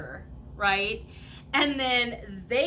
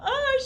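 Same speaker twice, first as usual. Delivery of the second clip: retold wail; 5 dB louder than usual. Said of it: "she's all".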